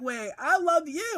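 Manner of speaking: high, slow imitation of her declaration; imitated giddy girlfriend. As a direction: insist on it high, drawing it out. Girlfriend tops his declaration.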